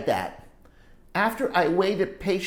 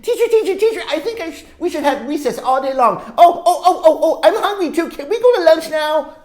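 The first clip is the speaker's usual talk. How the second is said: much higher, loud, laughing blurts; excited yelling with laughs; 9 semitones higher, 9 dB louder, faster than usual. Acted. Lewis yelling out at his teacher, laughing. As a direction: burst out giddy at the teacher. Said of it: "just started yelling out words".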